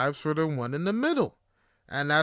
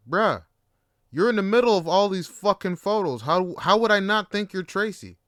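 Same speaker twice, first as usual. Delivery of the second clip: louder, higher indignant bruh; exasperated protest; 4 semitones higher, 6 dB louder than usual. Retold or imitated. Confronting date about photos, protests loud and high. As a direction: call her out in loud disbelief.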